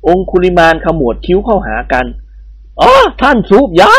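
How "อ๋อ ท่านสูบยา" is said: higher, sing-song Indian babu; lightly voiced Indian bandit. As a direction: play the amused bandit babu. Babu character voice.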